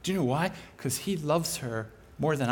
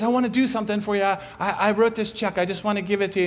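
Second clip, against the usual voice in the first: fast and a bit louder, eager; eager offer; 5 semitones higher, 4 dB louder, much faster than usual. Retold or imitated. Neighbor handing over a check, eager. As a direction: rush the offer out.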